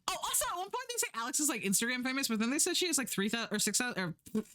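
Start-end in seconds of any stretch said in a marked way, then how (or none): none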